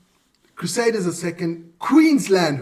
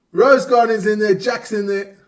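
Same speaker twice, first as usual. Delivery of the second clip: normal talking voice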